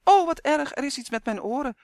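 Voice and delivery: high-pitched mock-alarmed shout. A narrator's reading. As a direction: cry out in theatrical dismay. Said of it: "schreeuwde de elf net zo hard". (hoog, overdreven geschreeuw)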